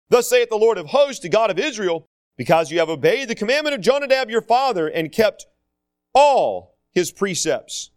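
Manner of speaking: preacher reading the Bible; scripture reading. Acted. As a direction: proclaim the verse from the pulpit.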